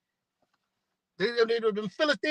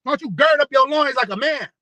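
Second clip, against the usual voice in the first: loud, higher challenge to weak men; scolding shout; 6 semitones higher, 6 dB louder than usual. Acted. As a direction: shout it like a preacher daring them. Voicing a scolding call to men.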